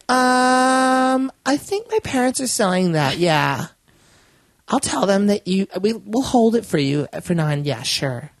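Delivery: put-on high, hesitant teen girl; awkward teen. Quoting the awkward teen on the phone, high and hesitant. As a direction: hedge it like an unsure teenager. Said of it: "she was, like, more awkward".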